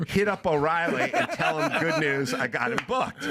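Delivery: laughing while quoting himself. Retold or imitated. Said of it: "I tell Mike".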